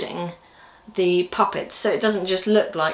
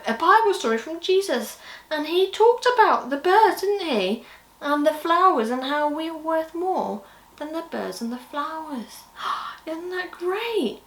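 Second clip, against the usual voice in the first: put-on reading to children; 8 semitones higher than usual. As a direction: tell it like a children's presenter, higher up. Telling children's Bible story.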